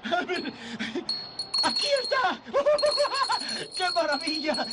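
high laughing discovery; laughing as he finds the petals; overjoyed laughter. Acted. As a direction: burst out laughing with relief. Finds Zuzu's petals in pocket.

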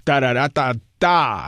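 "tararata, ta" with the last "ta" set apart; stressed final syncopation. Shown as a demonstration. Pianist showing the syncopation to highlight, final "ta" stressed. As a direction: detach and hold the closing "ta". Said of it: "eso hay que destacarlo".

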